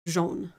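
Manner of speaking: jaune without any d at the start; French jaune. Shown as a demonstration. Finishing her explanation to a friend, French jaune. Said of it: "so it's just like".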